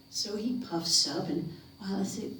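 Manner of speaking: ordinary delivery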